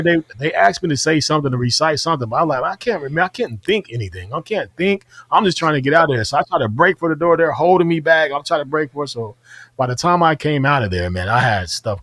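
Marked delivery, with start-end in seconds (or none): 2.6-6.2 high, breathless panic; mock panic. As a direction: plead like you can't think in the gas. Panicking recruit in the gas.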